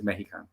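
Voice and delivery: "Mexican" softly with an h sound, meh-hi-can; "Mexican" quietly, Spanish h in the middle; Spanish-style Mexican. Retold or imitated. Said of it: "that's why it does say M E J".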